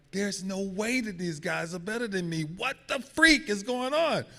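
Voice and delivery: can't believe they passed on you; disbelief. Retold or imitated. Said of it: "And I'm like".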